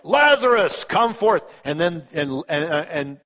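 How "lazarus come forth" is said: a big commanding call, higher and louder. Retold or imitated. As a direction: summon the dead man out of the tomb. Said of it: "but it's like".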